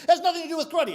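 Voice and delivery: high, escalating contradiction; complaining student. Acted. Student arguing with his sensei, high insistent protest. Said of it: "he complains to Sensei".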